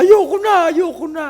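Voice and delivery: imitating a member who wants to quit; quitting whine. Imitating churchgoers who quit when trouble comes, mock wail. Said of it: "Pero pag may problema".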